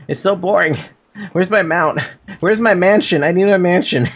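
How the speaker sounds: whiny. Acted. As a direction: whine like a spoiled kid wanting a mansion. Whiny gamer complaint.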